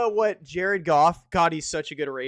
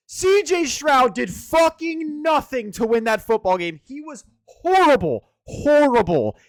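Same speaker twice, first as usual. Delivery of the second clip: higher, louder, slow outraged yell; furious shout; 7 semitones higher, 7 dB louder than usual. Real, not acted. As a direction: bellow it like he can't believe it. Ranting about overrated quarterback.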